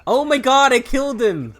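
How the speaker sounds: loud, high shocked exclamation. Real, not acted. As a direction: cry out at what you just did. Horrified by killing in game.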